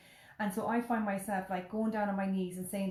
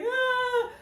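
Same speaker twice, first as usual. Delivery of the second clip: high loud shriek; mock scream of a falling kid; 8 dB louder than usual. Son pushed down the slide, high shriek. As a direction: let out a falling squeal. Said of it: "He's like".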